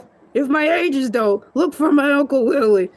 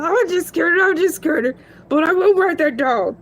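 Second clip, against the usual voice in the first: ordinary talk, nothing marked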